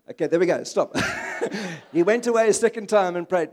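laughter spilling into the reading; chuckling while reading; laughing. Real, not acted. Reading scripture aloud, laughing.

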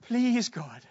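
high, drawn-out plea; acted desperate entreaty; heartfelt pleading. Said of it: "Get the heart of Moses".

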